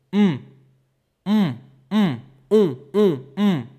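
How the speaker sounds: high humming mm through the nose; teacher humming to show nasal resonance; nasal hum. Shown as a demonstration. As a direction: hum with lips almost closed. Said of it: "make the sound only with my nose".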